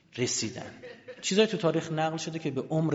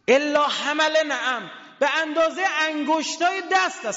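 wailing sermon lament; 7 dB louder than usual. Acted. Preacher lamenting the Prophet's words to mourners, high wail. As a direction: draw the hadith out like a lament.

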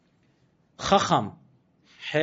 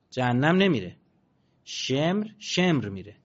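normal talking voice

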